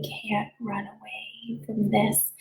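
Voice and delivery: hushed unvoiced whisper; a listener hears the teacher whisper the limit of running. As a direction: breathe it out as a secret.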